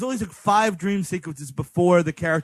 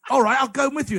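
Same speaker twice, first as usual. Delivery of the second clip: higher, eager mock British accent; Johnny Lee Miller; 7 semitones higher, faster than usual. Employee offering to come along, mock British accent. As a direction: put on a chimney-sweep British voice. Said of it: "uber British he's ever sounded".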